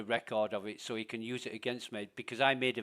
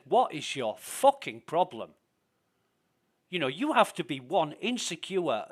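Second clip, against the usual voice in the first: louder and higher, furious tirade that builds; lost temper; 5 semitones higher, 6 dB louder than usual. Retold or imitated. Streamer replaying how he lost it with a troll, furious yelling. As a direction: blow up at him, reliving it. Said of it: "until I lost my temper with him".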